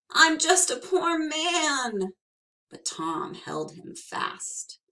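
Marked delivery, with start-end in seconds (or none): 0.1-2.1 high, slow pleading whine; whining. Acted. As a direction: wheedle for pity. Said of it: "wiggled and twisted and whined".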